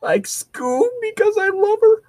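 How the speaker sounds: very high, cracking cartoon whine; high-pitched mock sobbing impression; Shaggy. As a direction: squeak it out like a tearful cartoon slacker. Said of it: "trying to do a Shaggy impression".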